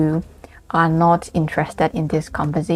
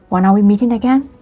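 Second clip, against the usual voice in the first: quick, higher question climbing to "again"; rising checking question; 4 semitones higher, 4 dB louder, faster than usual. Shown as a demonstration. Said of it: "when you want to check something".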